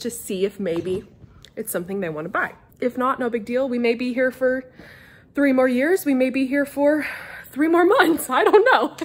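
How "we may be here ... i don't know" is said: louder, higher, breaking into laughter; laughing. Real, not acted. Telling viewers they might move soon, laughing out loud. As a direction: laugh through the punchline. Repeated with changed ending.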